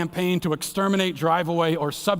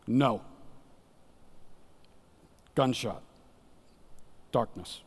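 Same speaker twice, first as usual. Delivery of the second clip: hushed, dropping low; 6 semitones lower, 9 dB quieter, slower than usual. Acted. Grim climax of martyr story.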